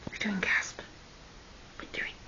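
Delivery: soft whispered question. Real, not acted. Owner peeks at her parrot, whispering. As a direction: barely breathe it so the bird stays calm. Owner checks on the bird quietly.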